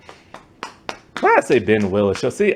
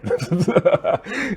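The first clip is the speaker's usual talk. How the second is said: short, higher-pitched chuckle; amused laugh; 9 semitones higher than usual. Real, not acted. Vlogger joking that the killer never died, amused laugh. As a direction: let out a laugh at your own idea. Laughs at own movie theory.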